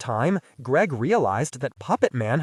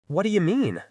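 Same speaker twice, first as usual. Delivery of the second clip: sharply higher, alarmed question; 7 semitones higher than usual. Acted. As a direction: ask in sudden worry. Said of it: "asked Tatch, alarmed".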